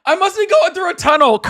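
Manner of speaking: high, loud mock excuse; mocking phone excuse. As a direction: ham up the fake bad signal. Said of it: "He pulled a".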